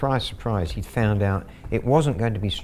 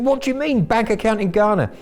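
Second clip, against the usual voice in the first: much higher and louder, outraged; mad scammer; 12 semitones higher, 4 dB louder than usual. Retold or imitated. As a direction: blow up like a furious scammer. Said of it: "He went mad".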